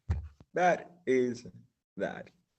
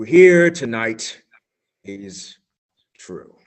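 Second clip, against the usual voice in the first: solemn, louder announcer read, dropping to a hush at the end; dramatic announcer; 8 semitones higher, 14 dB louder than usual. Acted. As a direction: announce it like an ominous radio bulletin.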